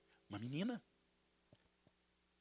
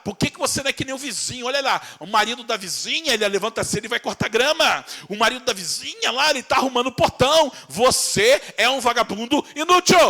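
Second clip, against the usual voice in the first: mock nagging spouse; 5 semitones higher, 6 dB louder than usual. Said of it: "você começa a comparar".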